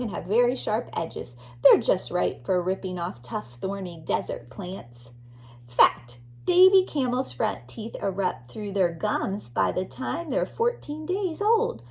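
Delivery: normal talking voice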